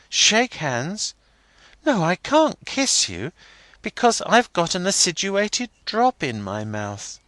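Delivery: put-on high, girlish schoolgirl voice; young girl Rosa. A narrator's reading. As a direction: lift into a bright young girl.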